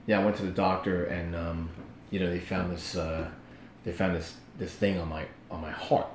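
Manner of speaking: very low, soft, hesitant confession; halting and subdued, breaking bad news; imitating downcast friend. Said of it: "looks like he just lost his best friend".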